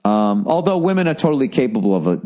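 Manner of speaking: usual speaking voice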